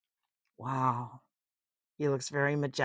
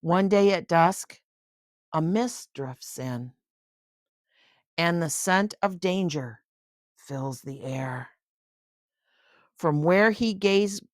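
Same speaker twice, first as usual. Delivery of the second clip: storybook narration, lively and clear; bedtime-book reading voice; reading to a child; 4 dB louder than usual. A narrator's reading. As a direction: read it aloud to a little one.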